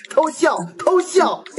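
laughing. Acted. Reads emoji tag while laughing.

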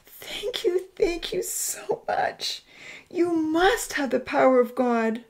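high, tearful gratitude; happy sobbing. Acted. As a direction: gush thanks through acted happy tears. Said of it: "the woman sobbed happily".

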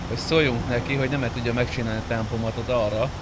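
usual speaking voice

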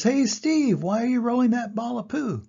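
high, loud animal call; curious pangolin. Acted. Pangolin hails Steve, high and eager. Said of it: "The pangolin says".

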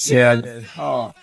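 low, quiet and defeated; deflated after losing it all; dejected. Real, not acted.

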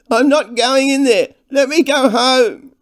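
high, loud wail of protest; panicked shouted refusal; loud cry. A narrator's reading. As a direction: yell it high, dragging out the words. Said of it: "a much louder cry rang out".

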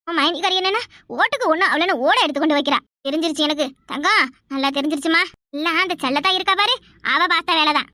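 ordinary talk

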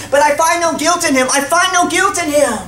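shooting back. Acted. Pilate answers the yelling crowd, insistently shooting back. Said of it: "Pilate shoots back".

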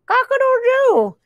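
very high rooster crow; crowing kitty. A narrator's reading. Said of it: "Look at the kitty".

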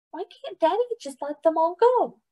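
put-on high small child voice; little girl voice. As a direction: ask like a young girl. Little sister asks.

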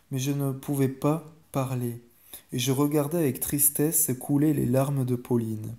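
usual speaking voice

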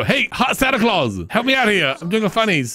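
hammy joke delivery as Miranda; amateur stand-up comedian. Pretend. Comedian telling the room a Santa and Rudolph joke, amateur stand-up patter.

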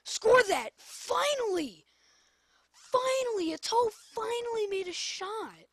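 excited cheer. Real, not acted. Celebrating a long-awaited shot.

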